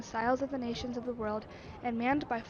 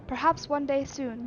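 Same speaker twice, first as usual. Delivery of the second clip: ordinary talk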